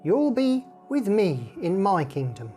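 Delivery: usual speaking voice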